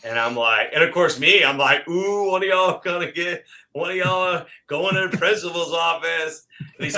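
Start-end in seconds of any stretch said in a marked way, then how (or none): 1.9-6.5 higher, laughing sing-song tease; teasing laugh. Retold or imitated. To the room as Don calls someone out, laughing teasing. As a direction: giggle like a kid watching a classmate get in trouble.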